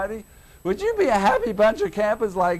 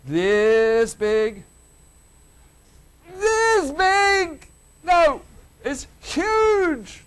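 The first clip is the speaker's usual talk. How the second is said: rising much higher and louder to a shout; building excitement; 9 semitones higher, 5 dB louder, much slower than usual. Shown as a demonstration. Preacher showing great gladness to the congregation, building to a loud high shout. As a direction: grow bigger with each "This big?". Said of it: "is very great, great gladness like this big".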